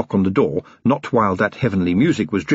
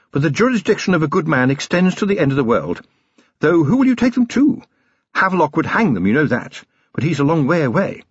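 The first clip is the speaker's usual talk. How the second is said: higher-pitched, firm lady's voice; resolute wife; 6 semitones higher than usual. Acted. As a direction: urge a husband to act on principle. Sybil urging her husband on.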